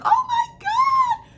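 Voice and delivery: very high, loud, slow strained mom imitation; exaggerated mom startled by crying child; hammed-up wailing "Oh my God"; mom's shocked cry. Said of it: "she was like".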